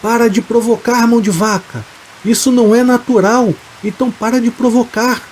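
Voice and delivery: high, urgent yell at little extra volume; father's shout. A narrator's reading. Father orders Fidêncio to stop provoking, high-pitched shout. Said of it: "enquanto o pai dele gritava".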